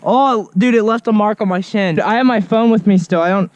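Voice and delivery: ordinary delivery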